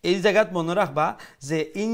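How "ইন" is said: ইন with a long hummed n; sustained nasal ringing on ইন; ghunnah. Shown as a demonstration. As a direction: hold the nasal n at the end of ইন. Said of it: "ওখানে লিগে আপনার গুন্না".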